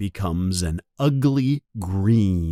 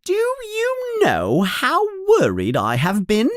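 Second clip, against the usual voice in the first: high, shrill-pitched scolding; angry mother. Acted. Mother scolding her sons for vanishing, angry and high. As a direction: scold them like a frantic mum. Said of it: "says Missus Weasley angrily".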